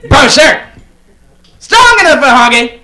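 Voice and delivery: hammed-up ad jingle, rising to a high, loud shout; mock commercial pitch. Chappelle doing the deodorant commercial, hammed-up shouting pitch. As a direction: sell it like a loud TV ad. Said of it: "get Dave Chappelle to do the commercials".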